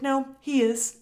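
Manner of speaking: imitating a kid's firm correction, high and loud; insistent child. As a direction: insist loudly like a little niece. Niece insists in retold argument.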